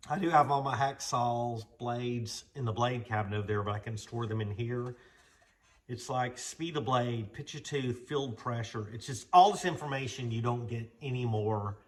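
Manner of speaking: ordinary talk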